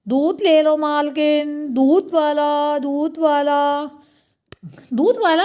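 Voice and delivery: slow, drawn-out vendor cry; milkman's street call. Acted. Milkman calling the lady of the house, drawn-out. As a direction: stretch the words like a hawker.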